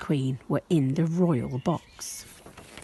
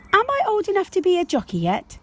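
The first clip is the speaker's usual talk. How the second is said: put-on high, quick child's voice asking; a small girl begging to be grown up; eager little girl; 13 semitones higher, faster than usual. Josie asks her parents, high eager child voice.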